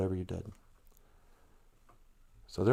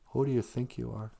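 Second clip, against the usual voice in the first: "Who do you think you are?" repeated much softer, leaning in; principal's jab; 2 semitones higher, 8 dB quieter than usual. Retold or imitated. Principal repeating his question to the kids, quietly mocking. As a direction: drop the voice and needle them. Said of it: "And he goes".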